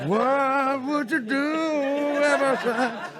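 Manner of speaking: high, slow, dreamy drawn-out words; slow-motion Wonder Years moment. Retold or imitated. Recalling seeing Lorne laugh, slow-motion dreamy voice. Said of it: "Wonder Years moment".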